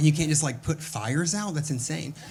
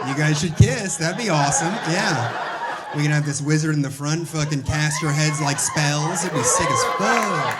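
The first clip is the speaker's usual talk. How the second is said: louder and higher, laughing as he teases; teasing riff; 4 semitones higher, 7 dB louder than usual. Real, not acted. Comic to two audience members, loud laughing tease. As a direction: rib the crowd, grinning.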